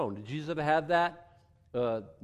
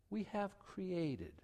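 we have created said much softer and slow; created said much softer, slowly; dropped low and soft; 4 semitones higher, 12 dB quieter, much slower than usual. Shown as a demonstration. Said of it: "I'm bringing my hands down lower for a reason".

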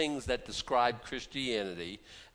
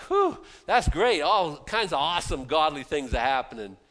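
mock satisfaction; 3 semitones higher, 8 dB louder than usual. Preacher mocking a satisfied news watcher, sarcastic. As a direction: play the smug viewer who's fine with everything.

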